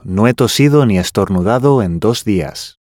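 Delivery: ordinary talk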